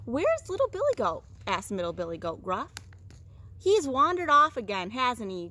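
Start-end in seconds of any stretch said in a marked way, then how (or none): 0.1-1.2 high, loud questioning goat; worried middle goat. A narrator's reading. Middle goat asks after missing brother, high worried voice. 3.6-5.5 loud, mildly grumbling complaint; grumbling big goat. A narrator's reading. Big goat complains about wandering brother, mild grumble. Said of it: "grumbled big Billy Goat".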